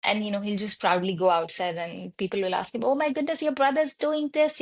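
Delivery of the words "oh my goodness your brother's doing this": higher, exclaiming in surprise; lightly imitated shocked parent; mock amazement. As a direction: gasp it out like a startled stranger. Strangers in the park exclaiming.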